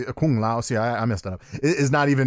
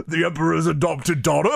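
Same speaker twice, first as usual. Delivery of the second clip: quoting inflection; 4 semitones higher than usual. Retold or imitated. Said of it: "it was perfect inflection".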